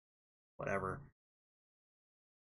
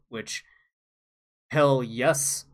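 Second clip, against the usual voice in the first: ordinary delivery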